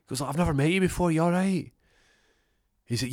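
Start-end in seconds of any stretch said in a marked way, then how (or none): 0.2-1.7 high, loud, worked-up babble; frantic concern. Acted. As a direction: blurt it out, still rattled. Acted frantic talk after shooting.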